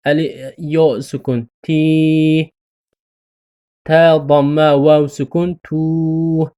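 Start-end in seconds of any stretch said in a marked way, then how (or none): 1.6-2.5 تی with a long "ee"; long tee. Shown as a demonstration. Teacher demonstrating ta with long ya, stretched. 5.6-6.5 تو with a long "oo"; long too. Shown as a demonstration. Teacher demonstrating ta with long waw, stretched.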